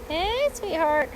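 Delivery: high, slow, cooing hello; sweet hello to a bee on her hand; tender greeting. Real, not acted.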